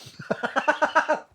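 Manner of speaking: airy high-pitched burst of laughter; breathy high laugh. Real, not acted. Reacting to the story.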